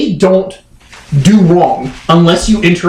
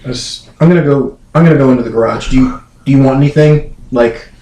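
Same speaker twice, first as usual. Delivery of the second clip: 1 sheepish guilty dog; 9 semitones lower than usual. Retold or imitated. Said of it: "You might go".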